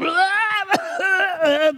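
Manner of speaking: high, strangled mock cry; acted choking noise. Servant grabs his fellow servant, hammed-up choking noise. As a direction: imitate choking someone, high and drawn out. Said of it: "began to choke him".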